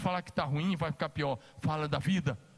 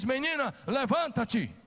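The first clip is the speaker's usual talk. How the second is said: Jesus calling; 7 semitones higher, slower than usual. Acted. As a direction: raise her with a clear command. Jesus calls the dead girl. (Jesus chama a menina morta, em voz alta)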